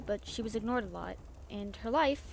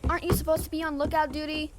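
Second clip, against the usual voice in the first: loud, high captain's yell across deck; shouted call; 5 semitones higher, 8 dB louder than usual. Acted. Captain shouts at the twins on lookout, loud scolding call. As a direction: bellow at the slacking kids. Captain yelling at cabin kids.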